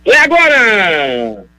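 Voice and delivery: drawn-out, ringing crime-report intro; dramatic radio announcer. Acted. Radio host introducing morgue news to listeners, dramatic announcer delivery. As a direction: herald the segment with stretched vowels.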